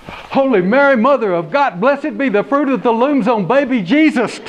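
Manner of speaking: high, loud startled cry; shocked exclamation. Acted. Deputy seeing the snake, shocked and loud. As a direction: blurt it out in panic.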